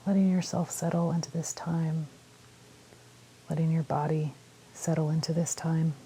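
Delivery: calm meditation guide with long pauses; unhurried phrases, leaving space to breathe; guided meditation. Real, not acted. Guiding listeners through a body scan, calm meditation voice.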